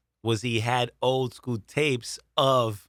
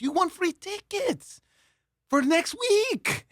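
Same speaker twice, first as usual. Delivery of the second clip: much higher, slow, fake-excited pitch; milk the prize like a cheesy game-show host; hammed-up salesman; slower than usual. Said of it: "He goes".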